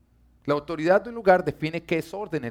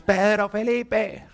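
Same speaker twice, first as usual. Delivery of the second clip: slow, high, stretched "Pedro Felipe" called from afar; angry mom call; 8 semitones higher, 5 dB louder, much slower than usual. Retold or imitated. Mother summons her son by both names when angry, slow and high. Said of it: "estoy en-, brava".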